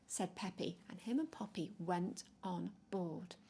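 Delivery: ordinary delivery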